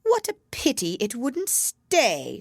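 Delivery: high, slow regretful bird voice; drawn-out, high sigh of regret; sighing Lory. A narrator's reading. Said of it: "sighed the Lory".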